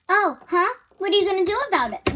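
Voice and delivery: high, quick mock challenge; taunting comeback. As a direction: act out a sassy dare. Retold taunt in a quarrel.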